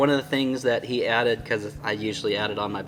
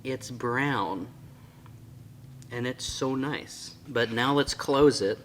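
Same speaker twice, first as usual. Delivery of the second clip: ordinary delivery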